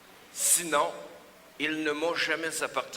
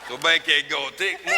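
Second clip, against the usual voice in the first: loud; 8 dB louder than usual. Acted. Clown feeding old lady.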